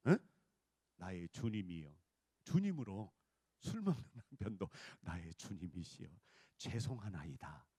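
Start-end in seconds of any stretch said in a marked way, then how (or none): 1.0-1.9 low, reverent mock-formal address; pious letter voice. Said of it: "편지 써놓고 왔거든". 5.0-7.6 very low, soft, mock-humble apology; pious letter voice. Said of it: "거기다 써놨단 말이야".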